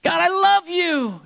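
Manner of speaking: slow, high, loud cry of love; acted worshipful outburst, loud and drawn out; exuberant devotion. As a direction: belt it out to God, stretched long. Acts worship swinging back up.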